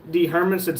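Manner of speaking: ordinary talk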